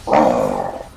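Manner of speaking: deep, distorted monster growl. Pretend. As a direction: drop into a low growling monster. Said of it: "was my monster voice".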